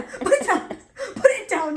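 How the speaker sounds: high, loud, laughing through it; laughing plea. Retold or imitated. Pleading with mom to drop palette.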